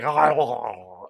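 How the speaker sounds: slow, low garbled groaning; drawn-out monster mumble, low; cockroach man impression. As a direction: imitate a movie bug creature's wordless moan. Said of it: "He's like".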